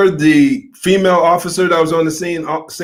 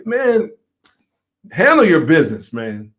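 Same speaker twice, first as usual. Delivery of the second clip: worked up; 2 semitones higher than usual. Real, not acted. Commentator urging officers to handle their business, worked up. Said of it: "I get worked up over stuff like this".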